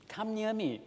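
high, emotional appeal; emotional plea. Acted. Joseph drawing his brothers close, high emotional plea. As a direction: call out with pent-up emotion, pitched well up. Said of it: "emotional stress is there".

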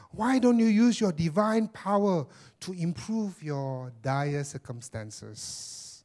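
high, sly tempter voice; sinister devil voice. Acted. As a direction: tempt him like a scheming devil. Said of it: "Do I sound si-, sinister?".

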